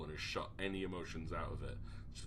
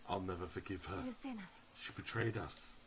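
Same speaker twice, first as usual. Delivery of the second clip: ordinary talk, nothing marked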